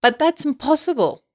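high-pitched disbelief; protesting cry. A narrator's reading. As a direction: object in astonishment, voice shooting up. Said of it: "they cried".

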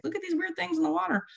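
put-on high, fast, wide-eyed kid voice; a lightly voiced eager calf; curious baby dolphin. Calf to mom about the divers, high and eager.

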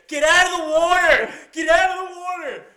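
yelling. Retold or imitated. Moviegoer yelling at people on screen, high frantic shout. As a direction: yell it out loud, stretching the words. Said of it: "I literally yelled in the movie theater".

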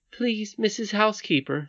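put-on high little-girl voice; pleading princess. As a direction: appeal politely in a child's high pitch.

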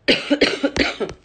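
hard dry cough; rough cough into the mic; coughing fit. Real, not acted. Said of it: "Desculpa aí pela tosse".

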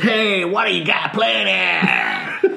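slow, high mock Chicago gangster; Chicago accent. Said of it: "in his best Chicago accent".

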